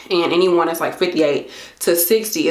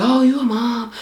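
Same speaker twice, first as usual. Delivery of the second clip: mocking critics; 3 semitones higher than usual. Imagined critics judging her.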